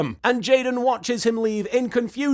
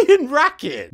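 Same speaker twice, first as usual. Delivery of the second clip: louder, higher, laughing the name out; 7 semitones higher, 6 dB louder than usual. Real, not acted. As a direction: giggle it out in delight.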